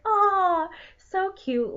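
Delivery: gushing. Retold or imitated. Gushing over cute scene.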